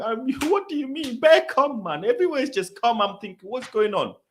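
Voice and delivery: high-pitched, bewildered complaining; exasperated protest. Retold or imitated. Confused fan argues with official over queue, exasperated. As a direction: protest in disbelief, voice pitched right up.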